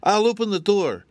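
high, dutiful official rabbit; officious rabbit. A narrator's reading. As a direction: explain the rules self-importantly. Rabbit agrees.

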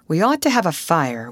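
ordinary delivery

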